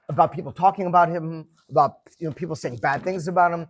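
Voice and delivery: ordinary delivery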